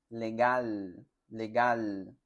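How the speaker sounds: "Legal" with the tongue tip up on both Ls; clear L. Shown as a demonstration. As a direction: say "legal" twice, crisp L at each end. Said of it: "Y se pronuncia poniendo la punta de tu lengua".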